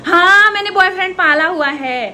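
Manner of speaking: exclaimed in playful indignation; loud mock retort. Real, not acted. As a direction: throw it back loudly, teasing. Sarcastic comeback in teasing banter.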